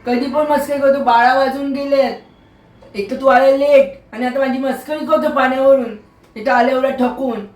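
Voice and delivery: high-pitched, acted telling-off; annoyed scolding. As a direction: tell off a joker with rising irritation. Scolds him for joking when late.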